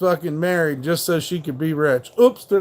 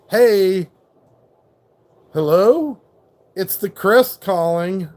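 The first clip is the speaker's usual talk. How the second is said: louder, hammed-up phone greeting; mock caller voice; 2 semitones higher, 3 dB louder, slower than usual. Streamer mocking a viewer who calls himself the Chris, mock caller voice. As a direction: announce yourself like a cheesy caller. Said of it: "can you talk like that the whole stream".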